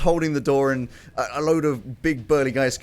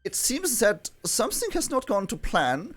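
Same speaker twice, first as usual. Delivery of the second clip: put-on raised, accented koala villain voice; theatrical koala character; 5 semitones higher than usual. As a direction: plead your case in a put-on accent. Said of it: "and who says".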